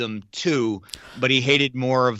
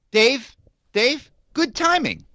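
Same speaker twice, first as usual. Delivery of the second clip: mock squeaky voice; 13 semitones higher, slower than usual. Host teases his cohost in a mock squeaky, flattered voice. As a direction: squeak it out like a giddy fan. High greeting to listener.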